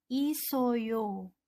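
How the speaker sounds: "isoyo" with s carried into "oyo"; Korean demo word. Shown as a demonstration. Teacher models the Korean "isoyo" for students. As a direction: glide "isoyo" as one smooth word. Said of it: "Jadi dibacanya menjadi".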